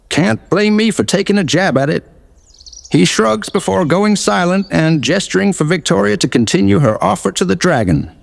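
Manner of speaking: ordinary talk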